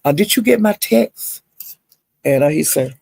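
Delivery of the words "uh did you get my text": smart remark. Retold or imitated. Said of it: "she'd say something else smart".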